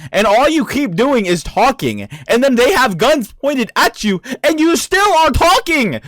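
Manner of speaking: high, rising exasperation; exasperated rant. Real, not acted. Exasperated rant at talkative villain.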